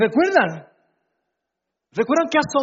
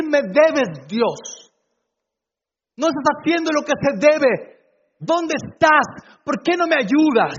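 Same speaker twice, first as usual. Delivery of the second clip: acted complaint, raised and insistent; indignant demand; 6 semitones higher, 4 dB louder than usual. Imagined believer reproaching God for not helping, indignantly. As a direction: demand answers as an aggrieved believer.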